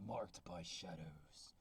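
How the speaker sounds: deep slow Game of Thrones style title read; low epic voice. Acted. Reading the book title to viewers in a deep epic trailer voice. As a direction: announce it like an epic fantasy trailer. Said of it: "Game of Thrones voice".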